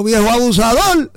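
put-on high-pitched jeer at an old man; mock scolding yell. Passerby to old man riding the donkey, mock scolding yell. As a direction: call out an accusation, hammed-up. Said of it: "para que la gente no gritara".

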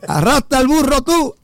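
high-pitched mock outrage; retold yell, voice jumping high; passerby's scolding shout. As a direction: imitate another heckler calling out accusingly. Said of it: "pasó otro y dijo".

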